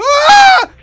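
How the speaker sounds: piercing, high scream of fright; sudden full-voiced shriek; startled scream. Real, not acted. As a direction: scream out loud at a jump scare. Jump scare.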